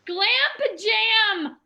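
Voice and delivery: high, slow, stretched-out cheer; excited. Real, not acted. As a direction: savor the name with glee. Excitedly echoing the event name.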